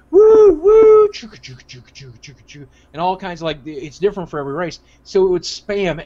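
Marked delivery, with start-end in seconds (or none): 0.1-1.1 loud, high "woo woo"; high sustained mock train toots; train whistle imitation. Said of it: "everybody would be going". 1.1-2.7 quiet rhythmic train chug; soft chuffing like a toy train; chugging train imitation. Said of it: "everybody would be going".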